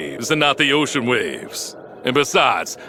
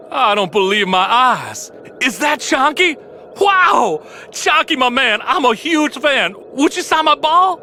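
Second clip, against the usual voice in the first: starstruck fan; 9 semitones higher than usual. Acted. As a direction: squeal at your idol and beg for an autograph. [name] meets his hero [name].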